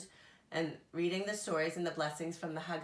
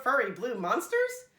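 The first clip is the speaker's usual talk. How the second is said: high puzzled bird voice; Big Bird voice; 5 semitones higher, 7 dB louder than usual. A narrator's reading. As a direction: wonder aloud as Big Bird.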